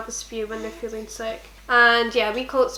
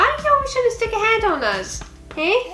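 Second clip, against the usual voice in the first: put-on baby talk; 10 semitones higher than usual. Parent talking to baby playfully.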